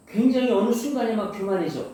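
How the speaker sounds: ordinary delivery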